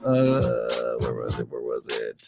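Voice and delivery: muttered under the breath; muttering to himself. Real, not acted. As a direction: mumble it while searching. Searching for a name, mumbling.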